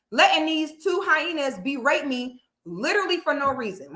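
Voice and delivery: ordinary delivery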